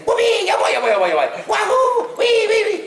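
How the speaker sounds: high, bouncing Mario cheers. Retold or imitated. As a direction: whoop it up like Mario. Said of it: "I just said".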